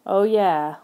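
ordinary delivery, nothing marked

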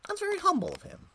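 mock praise.

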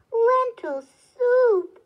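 slow, loud, high exclamation of disbelief; dismayed cry. A narrator's reading. Woodcutter hears what supper is, crying out in dismay. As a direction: cry out each word, drawn out and appalled. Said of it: "cried the woodcutter".

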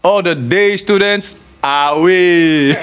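high, slow, loud call of the strict teacher; Ghanaian teacher. Retold or imitated. Teacher sending the day students home, loud call. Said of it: "this is always what he says".

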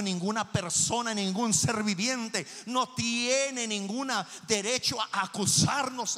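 emphatic, building sermon voice; forceful preaching. Real, not acted. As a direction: proclaim it boldly to the congregation.

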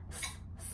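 hissed "S, S, S" unvoiced like a snake; s sound. Shown as a demonstration. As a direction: stretch each "S" into a hiss. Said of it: "S is for spider".

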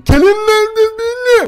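very high, loud, drawn-out yell; full-on high-pitched holler; shouting. Acted. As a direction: bellow it up at a window, slowly. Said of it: "diye bağırdım".

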